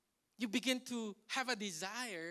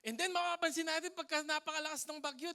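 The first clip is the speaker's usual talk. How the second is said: ordinary talk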